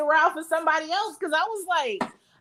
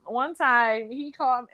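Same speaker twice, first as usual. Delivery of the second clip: normal talking voice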